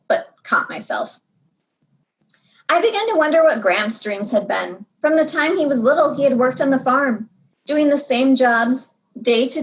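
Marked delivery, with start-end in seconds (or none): none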